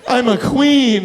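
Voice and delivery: loud, high mock drag queen. Comic playing a drag queen for the crowd, high and loud. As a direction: call it out big as a hammed-up queen. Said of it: "watch some guy who looks like me".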